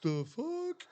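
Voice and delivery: high sharp confused "the fuck"; startled. Retold or imitated. Driver hearing the truck rattle, startled. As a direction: yelp it in confusion. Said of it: "And I'm like".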